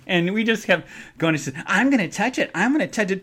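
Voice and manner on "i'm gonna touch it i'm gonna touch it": lightly voiced taunting friend, a bit higher; sing-song tease about touching it; teasing voice. Teasing the friend at the counter, a touch higher.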